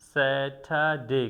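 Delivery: slow স্যাটারডে with the R silent, "sa-tha-day". Shown as a demonstration. Teacher modelling the day's name, slow with silent R. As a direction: stretch out স্যাটারডে, dropping the R. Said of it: "তাহলে এটাকে আমরা বলবো".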